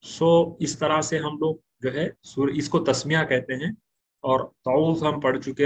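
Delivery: usual speaking voice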